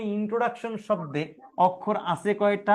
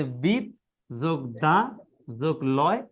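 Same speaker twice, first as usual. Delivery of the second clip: বিদ, দা, লয় slowly, each set apart; slow piece-by-piece বিদ, দা, লয়; broken into syllables; 5 semitones lower, slower than usual. Shown as a demonstration. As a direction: count off বিদ plus দা plus লয় as separate pieces. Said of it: "এটাকে বলা হয় অক্ষর".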